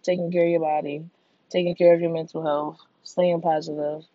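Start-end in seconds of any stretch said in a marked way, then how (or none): none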